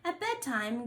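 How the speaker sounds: plain reading voice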